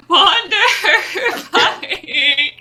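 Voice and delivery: high, laughing, crying mock panic; tiny creature wailing to be saved; panicked squeal. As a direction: squeak out a terrified plea. Player voicing panicked Micah.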